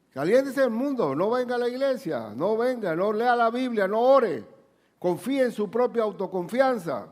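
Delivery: high, loud preaching build; preaching at full force. Acted. Preacher rising to the congregation. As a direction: preach it out like a warning. Preacher mocks worldly advice.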